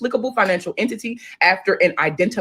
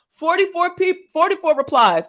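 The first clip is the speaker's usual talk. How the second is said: incredulous; 8 semitones higher, 3 dB louder than usual. Real, not acted. Incredulous at reply count.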